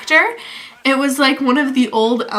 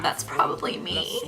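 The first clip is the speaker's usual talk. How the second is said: whisper; 12 dB quieter than usual. Retold or imitated. Realising her name was called.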